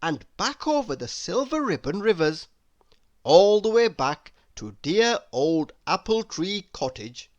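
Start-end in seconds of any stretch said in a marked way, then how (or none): none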